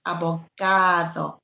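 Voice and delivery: "abogado" with a long, stressed GA. Shown as a demonstration. Teacher correcting the mistakes, "abogado" stressed on GA. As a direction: lengthen GA in "abogado". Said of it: "The stress is here".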